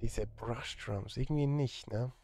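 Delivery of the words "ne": ordinary talk, nothing marked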